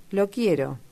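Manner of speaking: ordinary delivery, nothing marked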